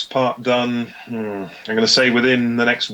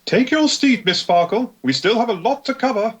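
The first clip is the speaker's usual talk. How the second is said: high, prim strict teacher; stern schoolmistress addressing a pupil; Gisela voice; 13 semitones higher than usual. Acted. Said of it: "I'll take, uh, Gisela".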